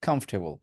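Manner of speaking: COMFORTABLE said "KUMF-tebol", no r; British-style comfortable. Shown as a demonstration. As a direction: say কমফোর্টেবল as kumf-te-bol. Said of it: "But আমি বলবো কমফোর্টেবল বলেন".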